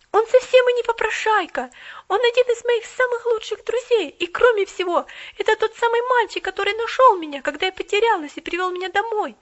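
put-on high, loud girl's heated defence; girl defending the boy, heated. A narrator's reading. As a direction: stand up for your friend. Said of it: "встала на защиту".

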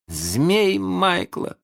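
name it slowly, with no interest; indifferent. A narrator's reading. Питер notes the kite to Венди, indifferent. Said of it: "сказал Питер равнодушно".

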